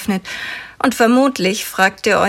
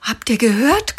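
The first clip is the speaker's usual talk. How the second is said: breathless gasp; 4 semitones higher than usual. Acted. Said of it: "keucht Malte atemlos".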